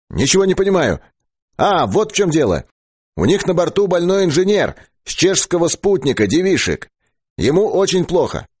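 very high loudspeaker voice; radio operator relaying news. Acted. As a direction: pass the message on over the radio, pitched well up. (очень высокий голос радиста из репродуктора)

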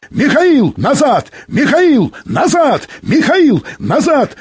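high, identical calls in a steady loop; insistent radio hail, evenly repeated; monotonous repeated call. A narrator's reading. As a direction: repeat each word on the same high falling tune. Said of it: "монотонно повторял Быков".

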